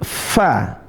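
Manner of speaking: "fa" with a long, airy f; angkat suaranya. Shown as a demonstration. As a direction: hold the f of "fa" before opening into the vowel. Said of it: "angkat suaranya". ("fa" dengan f ditahan dan suara diangkat)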